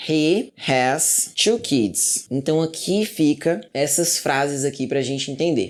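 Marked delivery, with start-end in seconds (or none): 0.1-2.3 very slow, deliberate English example; slow model sentence. Shown as a demonstration. Teacher showing students the new form, very slow. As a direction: drag each word for the learners. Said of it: "olha só como é que ficaria".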